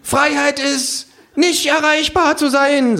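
high, slow kangaroo oration; orating kangaroo. Acted. Kangaroo on the counter lecturing the pub, high slow. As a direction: address the bar like a prophet.

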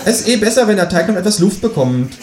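high-pitched kangaroo reply. A narrator's reading. Kangaroo happy to wait for mince, high voice.

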